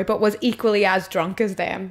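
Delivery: ordinary delivery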